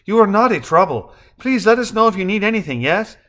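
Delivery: a Mexican hotel receptionist picking up a guest's call, brisk and polite; Spanish hotel clerk. A narrator's reading. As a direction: answer the phone as a cheerful Spanish-speaking receptionist.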